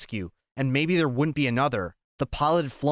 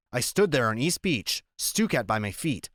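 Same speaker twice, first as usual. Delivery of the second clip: plain reading voice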